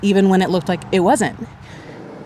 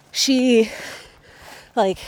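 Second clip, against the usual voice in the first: ordinary talk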